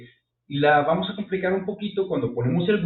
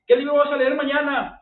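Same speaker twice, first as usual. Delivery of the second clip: higher translation; 12 semitones higher, 5 dB louder than usual. Shown as a demonstration. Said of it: "translating the example".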